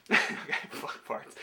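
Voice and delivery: slow whispered swear on request. Pretend. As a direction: breathe it out, no voice, slowly. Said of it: "Say something about fuck farts".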